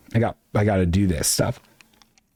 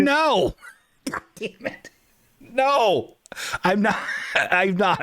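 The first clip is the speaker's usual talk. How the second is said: high, laughing no; laughing refusal; 8 semitones higher, slower than usual. Real, not acted. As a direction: refuse while cracking up.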